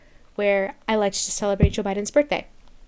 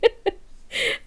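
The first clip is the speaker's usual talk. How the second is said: breathy giggle; 10 semitones higher than usual. Real, not acted. Laughing at own rant.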